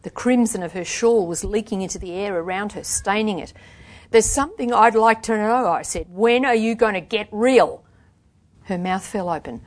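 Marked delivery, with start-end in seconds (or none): 4.1-5.7 louder, pointed challenge; confronting. A narrator's reading. Confronting her friend. 6.1-7.8 loud, raised exasperated demand; confronting. A narrator's reading. Confronting her friend.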